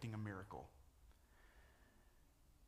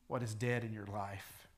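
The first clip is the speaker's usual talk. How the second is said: ordinary talk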